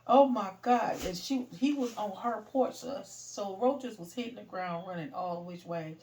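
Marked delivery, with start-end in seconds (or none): 0.1-1.0 mock panic. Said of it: "She was like".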